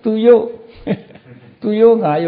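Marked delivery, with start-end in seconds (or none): 0.0-0.4 သူရိုး said with a y sound, higher and louder; mock mispronunciation. Monk mocking a careless reciter, sounding out သူရိုး. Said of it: "ရိုးတဲ့".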